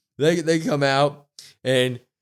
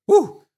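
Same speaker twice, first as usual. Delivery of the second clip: loud high whoop; excited whoop; 9 dB louder than usual. Real, not acted. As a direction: let out a big excited woo. Excited wrestling recap exclamation.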